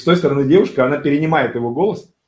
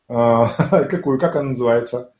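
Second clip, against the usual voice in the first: normal talking voice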